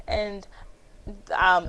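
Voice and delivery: normal talking voice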